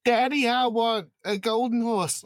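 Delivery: put-on high, whiny little girl asking daddy; a spoiled child begging; spoiled daughter. Daughter asks daddy for horse.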